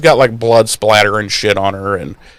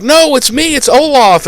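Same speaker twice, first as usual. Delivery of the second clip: high-pitched mock plea; hammed-up cry of protest; Olaf pleading; 14 semitones higher than usual. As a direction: protest high as the hunted troll. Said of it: "then Olaf's like".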